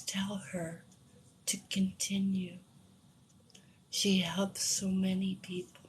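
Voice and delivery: soft, half-whispered relay; hushed message. Retold or imitated. Reader relays the dead husband's message, hushed. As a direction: pass it on quietly, in separated phrases.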